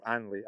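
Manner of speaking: soft "Anley", beginning on the A with no H; dropped H. Shown as a demonstration. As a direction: say "Anley" softly with the H left off. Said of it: "I seem to drop the H's".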